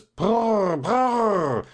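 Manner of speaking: long, high trilled horse snort; horse's prrr. Acted. Narrator imitating a snorting horse.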